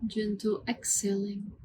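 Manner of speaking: calm, hushed meditation voice with long pauses; guided meditation. Acted. Yoga teacher leading students through mantra breathing, soothing guided meditation. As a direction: guide the breath gently, word by unhurried word.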